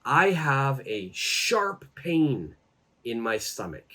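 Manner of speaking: slow, clear teacher demo; slow model phrase. Shown as a demonstration. Teacher modelling the phrase slowly for students, slow and clear. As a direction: spell it out slowly for learners. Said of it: "you could say".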